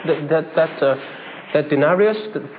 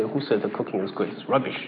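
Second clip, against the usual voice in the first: low, much softer, sour muttered put-down; scornful dismissal; 10 semitones lower, 8 dB quieter than usual. Retold or imitated. An unhappily married husband dismissing his wife's cooking, in soft scorn. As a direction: brush the compliment off with contempt. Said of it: "You may say".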